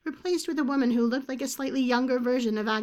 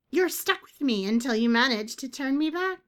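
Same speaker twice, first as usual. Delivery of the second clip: higher, louder sneering bird voice; taunting bird creature; 5 semitones higher, 6 dB louder than usual. Acted. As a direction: needle her as the bird.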